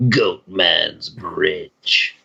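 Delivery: very slow, low ominous delivery; spooky legend name. Shown as a demonstration. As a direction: intone it low, stretching every word. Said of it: "can you say it again".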